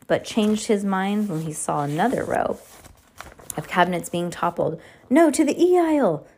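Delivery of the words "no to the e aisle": higher, urgent correction; urgent call. Acted. Klaus redirecting his sisters mid-escape, urgent and raised. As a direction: call it over the noise. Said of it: "Klaus answered".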